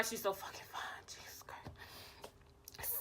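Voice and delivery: hushed, breath-only swooning aside; awed whisper. Retold or imitated. Swooning to self at crush's outfit, awed whisper. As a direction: whisper it to yourself, smitten.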